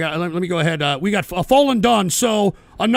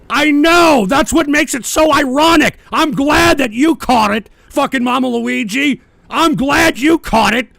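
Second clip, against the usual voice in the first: loud, high excited outburst; excited yelling; 4 semitones higher, 4 dB louder than usual. Real, not acted. Thrilled that a chatter caught the joke, loud and excited. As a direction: shout it gleefully.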